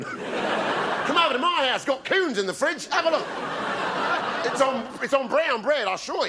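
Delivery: sing-song host showing off the fridge; mock invitation. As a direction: lure them in with a silly high voice. Mock invitation to see coon cheese.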